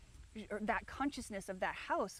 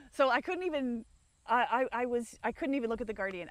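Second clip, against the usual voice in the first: choked up; 2 semitones higher, 6 dB louder than usual. Real, not acted. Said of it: "I've done the crying".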